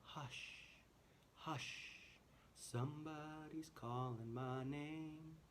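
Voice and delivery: very soft, breathy whisper. Shown as a demonstration. Teacher modelling the whispered verse. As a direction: hush it gently to the class. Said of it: "We wanna whisper it".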